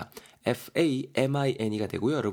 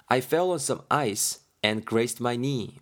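each word read out slowly; slow careful reading; much slower than usual. Shown as a demonstration. Teacher reading the line slowly for students. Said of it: "하고 있습니다".

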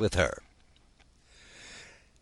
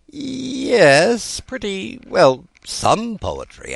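slow, hesitant, higher start trailing down; hedge, unsure of yourself; doubtful; 6 dB louder, much slower than usual. Acted. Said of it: "Alice said doubtfully".

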